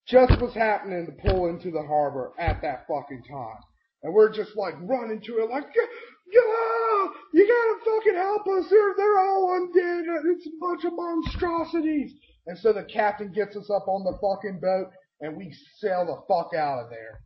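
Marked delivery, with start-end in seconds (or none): 5.8-12.1 very high, wailing plea for help; hammed-up terror, yelling as you flee; panicked screaming. Retold panicked plea while fleeing.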